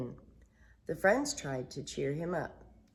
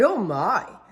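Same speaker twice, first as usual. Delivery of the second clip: alarmed Pooh; 3 semitones higher, 9 dB louder than usual. Acted. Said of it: "Pooh cried".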